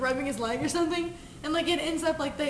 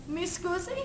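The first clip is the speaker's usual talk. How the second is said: put-on Italian accent; 5 semitones higher, 4 dB quieter, slower than usual. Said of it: "Italian guy walks in".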